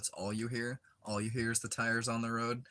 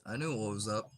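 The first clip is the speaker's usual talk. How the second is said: ordinary talk, nothing marked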